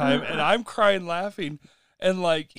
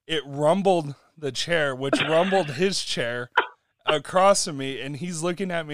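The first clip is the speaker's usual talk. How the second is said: normal talking voice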